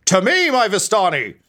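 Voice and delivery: high, loud rallying cry; villain yell. Acted. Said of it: "he just yells".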